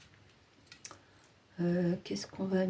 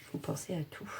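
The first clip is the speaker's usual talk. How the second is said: whisper; 6 dB quieter than usual. Real, not acted. Aside while crafting.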